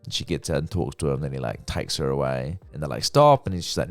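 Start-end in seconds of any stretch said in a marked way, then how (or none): 3.0-3.5 loud, high retold shout; alarmed call. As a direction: call out to halt them. Retold calling out during abduction.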